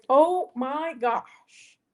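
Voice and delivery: surprised. Real, not acted. Cat interrupts her.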